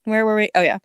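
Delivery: normal talking voice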